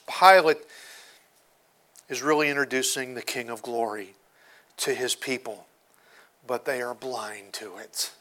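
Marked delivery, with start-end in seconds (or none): none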